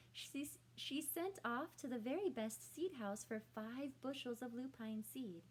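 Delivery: ordinary delivery, nothing marked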